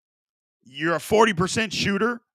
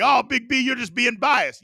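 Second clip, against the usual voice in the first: high mock-whiny critic voice; 7 semitones higher than usual. Mock-whining critic to Big B about bias. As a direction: put on the naysayer's whine. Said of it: "You might say".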